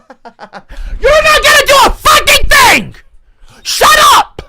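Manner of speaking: high shrieking shout through a laugh; laughing scream. Real, not acted. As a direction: shriek at the donor. Streamer screams at donor.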